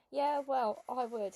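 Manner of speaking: plain reading voice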